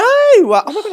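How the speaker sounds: loud, high-pitched real shock; astonished. Real, not acted. Host reminded of the prize win, astonished. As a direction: burst out in sudden disbelief.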